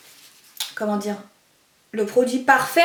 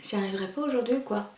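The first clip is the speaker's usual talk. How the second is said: frustrated mutter; 3 semitones lower, 10 dB quieter, faster than usual. Real, not acted. Struggling to put lens in.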